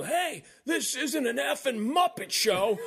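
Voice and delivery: high, loud, slow imitation of an irate fan; heckler's yell. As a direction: bellow it with gusto, drawing it out. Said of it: "with all the gusto would yell".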